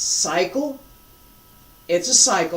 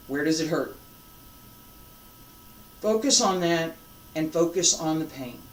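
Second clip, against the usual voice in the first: softer, lower guiding voice; guided meditation; 4 semitones lower, 5 dB quieter than usual. Acted. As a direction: quietly lead them inward to the pain. Guides focus onto the pain.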